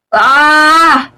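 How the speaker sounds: high loud yelp; startled scream. Real, not acted. Player caught by the guard, startled scream. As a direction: shriek as you get caught.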